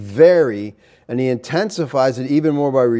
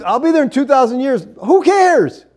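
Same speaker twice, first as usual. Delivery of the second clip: scornful mockery; 9 semitones higher, 3 dB louder than usual. Mocking a far-off promise.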